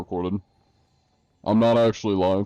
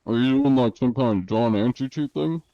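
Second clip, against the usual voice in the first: imitating a puzzled player; 4 semitones higher than usual. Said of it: "He goes".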